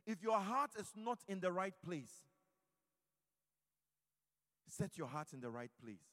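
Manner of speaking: first plea, forceful but held back; firm exhortation. Acted. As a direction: urge the listeners to set their hearts right.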